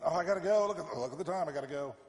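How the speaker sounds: loud, high mock panic, easing off; mock hurried excuse. Person fleeing a pastor on sight, mock flustered excuse. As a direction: blurt it like someone desperate to escape.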